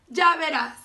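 high, loud warning; defiant threat. A narrator's reading. Grumpy ladybug warning the friendly one, loudly defiant. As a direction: throw out a huffy challenge.

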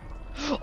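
sharp frightened gasp; startled gasp. Real, not acted. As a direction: gasp in sudden shock. Sees something outside.

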